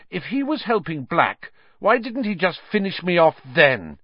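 put-on defiant young boy; Harry voice. A narrator's reading. Harry arguing.

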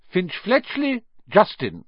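surname pitched high, first name dropping; high, projected name call; roll call. A narrator's reading. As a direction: call out the next name on the list. Teacher calls the roll.

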